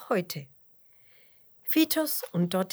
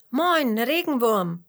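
loud, higher, curious hail; neugieriger Ruf; 5 semitones higher, 8 dB louder, slower than usual. A narrator's reading. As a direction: call out slowly with open curiosity. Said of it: "ruft Fitus neugierig". (laut und neugierig rufend)

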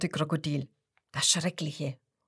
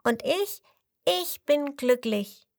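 happy little crocodile; 6 semitones higher than usual. A narrator's reading. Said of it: "Das fünfte Krokodil lacht".

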